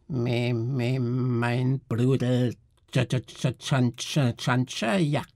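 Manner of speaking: high, halting creature stammer, slow on the brother; a bloated little creature struggling to get words out. A narrator's reading. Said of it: "stammelte das aufgedunsene Geschöpf".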